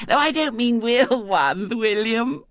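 put-on high impatient little girl; piping small-girl explanation; Violet Elizabeth. A narrator's reading. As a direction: correct him primly with a childish lisp. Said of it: "said Violet Elizabeth".

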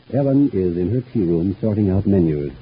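usual speaking voice

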